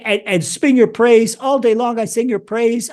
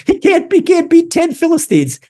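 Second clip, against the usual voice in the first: amused outburst; 9 semitones higher, 5 dB louder than usual. Real, not acted. Amused exclamation after laughter.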